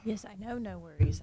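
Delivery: ordinary talk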